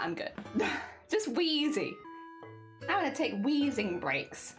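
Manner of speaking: ordinary talk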